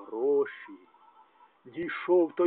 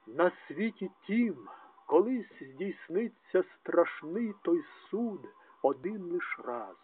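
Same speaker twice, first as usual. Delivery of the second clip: ordinary talk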